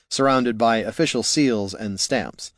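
ordinary talk, nothing marked

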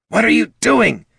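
high, loud, urgent kobold hiss; agitated little creature scolding. Acted. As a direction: demand it in a high, strained voice. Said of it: "hissed the kobold".

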